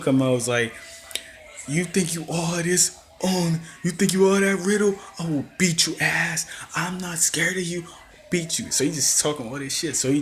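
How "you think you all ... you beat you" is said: higher-pitched taunting wrestler imitation; mock trash talk. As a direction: talk trash as the challenger, slightly hammed-up.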